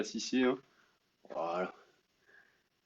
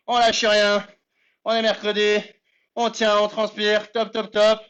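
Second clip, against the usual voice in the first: fitness coach; 6 semitones higher than usual. Acted. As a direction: pump up the class while exercising. Coach leading a live workout.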